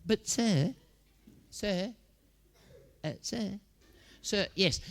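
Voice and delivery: high, small pupil voice; pleading schoolboy. Acted. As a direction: timidly try for the teacher's attention, high-pitched.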